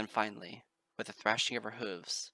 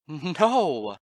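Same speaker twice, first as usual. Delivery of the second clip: loud, high cry; shout; 6 semitones higher, 15 dB louder than usual. Acted. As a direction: yell it in despair. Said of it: "I shouted".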